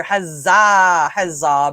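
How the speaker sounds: loud, drawn-out huzzah; hearty cheer. Real, not acted. Host greets Mister Frost in chat, hearty cheer. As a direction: hail them like a town crier.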